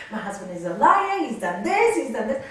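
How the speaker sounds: loud, piled-up accusations; retold angry complaint. Friend complains to the guru about husband, indignant. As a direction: imitate a friend ranting about her husband.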